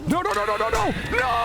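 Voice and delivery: rapid high-pitched cries; panicked refusal. Real, not acted. Gamer seeing danger close in, panicked cries. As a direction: cry out high in rising alarm.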